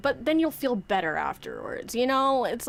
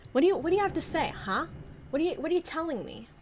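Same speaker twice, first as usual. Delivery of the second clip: rushed mock questioning; 7 semitones higher, much faster than usual. Talking to the wind.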